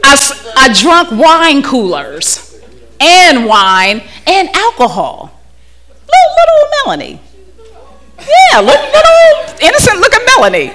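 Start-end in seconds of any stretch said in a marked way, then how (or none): 6.1-10.7 put-on high, sweet little-girl voice with a laugh; teasing herself in a tiny high voice; mock little girl voice. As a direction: play up how innocent she looked. Mocking her innocent younger self.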